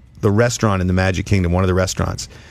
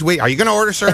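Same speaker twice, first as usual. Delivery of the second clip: sandwich lady; 11 semitones higher, much faster than usual. Retold or imitated. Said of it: "the sandwich lady's".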